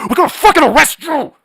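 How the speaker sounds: high, loud yell; shouted threat. Real, not acted. Host threatening political enemies, a loud high yell. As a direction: bark the threat at them.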